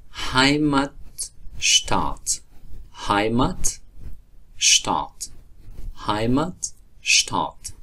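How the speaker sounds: هایمتشتات with sh-t before tat; German Heimatstadt. Shown as a demonstration. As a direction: model هایمتشتات. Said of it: "زادگاه".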